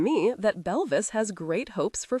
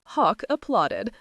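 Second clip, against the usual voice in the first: ordinary delivery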